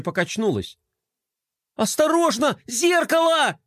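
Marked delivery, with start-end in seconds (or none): 1.8-3.6 very high-pitched cry of alarm; yelling warning. A narrator's reading. Brother yelling to sister as mirror topples, alarmed. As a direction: call out an urgent danger, pitch way up. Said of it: "завопил Флай".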